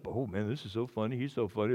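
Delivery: low, soft mock audience chatter; offhand mimicry. Preacher mimicking listeners who find him so funny, offhand mimicry. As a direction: toss it off quietly, mimicking listeners.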